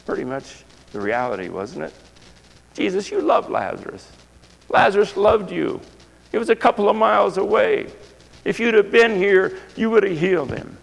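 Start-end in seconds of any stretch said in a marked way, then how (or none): 2.7-10.8 high, pleading complaint to Jesus; grieving sisters' plea. Acted.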